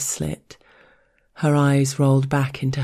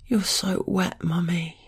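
higher breathy whisper; teasing whisper; 5 semitones higher, 4 dB quieter than usual. Acted.

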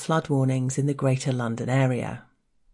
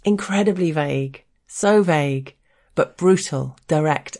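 normal talking voice

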